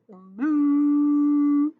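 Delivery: loud drawn-out moo; cow moo. Acted. Teacher voicing the cows with a long loud moo.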